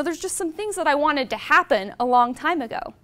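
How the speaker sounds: ordinary talk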